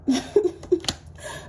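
short breathy laugh at herself. Real, not acted. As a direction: laugh off the anger. Laughs at own rant.

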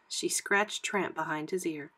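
ordinary delivery, nothing marked

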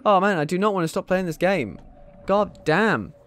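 loud frustrated groan; loud dismayed cry. Real, not acted. Game ends, dismayed cry.

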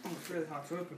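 ordinary delivery

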